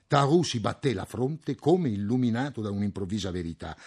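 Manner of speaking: ordinary delivery, nothing marked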